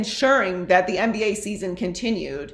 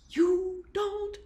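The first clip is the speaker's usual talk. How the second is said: exasperated, pitched way up; exasperated lecture; 9 semitones higher than usual. Real, not acted. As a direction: hammer it home in a high, incredulous voice. Exasperated lecture to clueless viewers.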